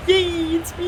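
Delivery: high squeaky excited friend voice; imitating Tara. Imitating Tara in chat for friends, high and squeaky. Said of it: "he'd be like".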